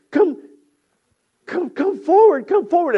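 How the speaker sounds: high, loud beckoning; urgent calling. Acted. Joseph beckoning his frightened brothers, urgent calling.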